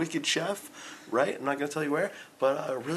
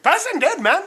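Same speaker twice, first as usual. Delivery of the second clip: put-on Pakistani accent; 10 dB louder than usual. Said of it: "He just said it so quick".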